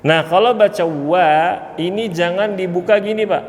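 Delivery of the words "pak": normal talking voice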